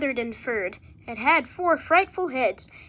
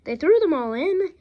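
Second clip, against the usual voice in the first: ordinary delivery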